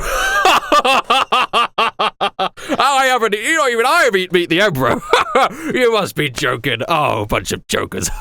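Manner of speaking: high, giggling dismissal; laughing guard. Acted. Guards laughing off the marines' request, high and giggly. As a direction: laugh them off as jokers. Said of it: "the two guards look at each other and they go".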